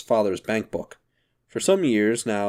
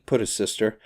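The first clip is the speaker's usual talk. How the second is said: ordinary delivery, nothing marked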